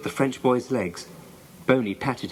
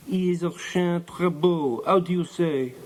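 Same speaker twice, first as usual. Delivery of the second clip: put-on slow, higher French-accented groping for words; French boy's accent; 5 semitones higher than usual. A narrator's reading. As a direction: search for the English in a French accent. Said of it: "turning to the French boy".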